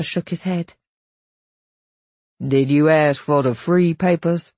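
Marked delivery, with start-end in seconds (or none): 2.4-4.5 lightly voiced Papa, pressing the question; a hint of a father demanding an answer; stern father. Papa asking Belle about free papers, lightly stern.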